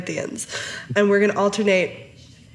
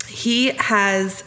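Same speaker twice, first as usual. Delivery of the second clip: ordinary delivery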